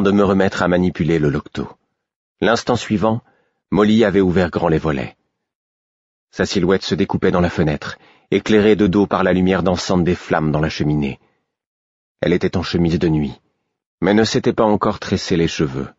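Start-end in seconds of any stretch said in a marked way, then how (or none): none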